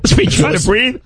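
laughing. Real, not acted. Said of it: "when I choked on Lifesaver".

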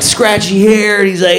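normal talking voice

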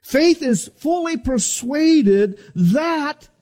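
preaching. Acted. Preacher proclaiming faith to the congregation. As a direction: build to a climax.

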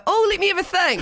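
high, quick imitation of Maddie; mock Maddie voice. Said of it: "You're gonna hear Maddie go".

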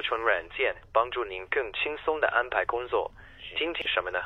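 friendly Mandarin Chinese phone greeting; 用中文，亲切地说. Shown as a demonstration. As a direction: answer the call in Mandarin, like a helpful receptionist. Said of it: "can you do it in Chinese?".

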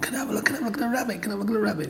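put-on high, quick pleading child voices; soft, high-pitched begging like schoolkids; eager kids. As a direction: imitate children clamouring for a look. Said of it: "all the kids would come round".

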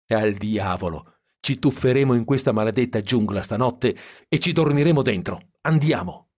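ordinary delivery

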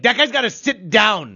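playful gripe from a blocked-view fan; imagined complaint. Pretend. Friend imagining her complaint behind a tall man, mock annoyed. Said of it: "You were probably like".

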